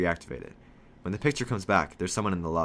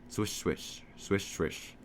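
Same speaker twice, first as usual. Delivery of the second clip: hushed, whispered swish of fabric; soft swishing noise; 6 dB quieter, much slower than usual. A narrator's reading. Narrator mimics phone sound from a pocket, soft swishing. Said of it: "I hear a swish swish sound".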